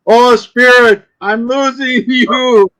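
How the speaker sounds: high, loud, drawn-out mock plea; stretched, wailing séance call; fake psychic calling spirit. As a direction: call out to a fading spirit, hammed up.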